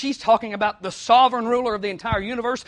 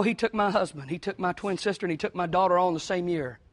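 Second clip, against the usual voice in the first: ordinary talk, nothing marked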